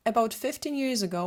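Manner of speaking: ordinary talk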